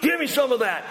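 high, urgent blurt; hungry man who can't wait; blurting Esau. Retold or imitated. Preacher voicing Esau blurting at Jacob, high and urgent. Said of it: "As Esau blurts out".

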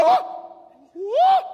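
very high, loud whoop; alarmed angel. Acted. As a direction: burst out in escalating alarm. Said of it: "the sixth, we go".

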